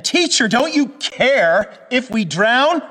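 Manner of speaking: high, loud, slow plea of panicked men; frantic shout to a sleeping teacher; desperate cry. Acted. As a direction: cry out over the storm as if drowning. Disciples cry out in storm.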